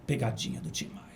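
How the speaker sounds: low, soft impersonated punchline. Retold or imitated. Singer revealing his prank to the crying secretary, low imitated voice. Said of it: "ela falou assim".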